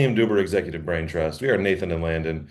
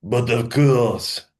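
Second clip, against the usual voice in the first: put-on slow, high hammy French accent; drawn-out mock French; 5 semitones higher, 4 dB louder, much slower than usual. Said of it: "offensive French accents today".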